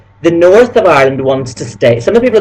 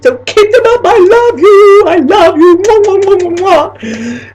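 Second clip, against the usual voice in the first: much higher, slow, louder love-you and kiss sounds; gushing kisses; 4 dB louder, slower than usual. Acted. Thanking her fans, gushing with kisses. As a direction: blow kisses down the camera.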